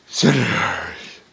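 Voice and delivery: breathy slow whisper on one word; whispered sneer. Acted. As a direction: drop to a scornful whisper, drawn out. Sneering aside in a rant.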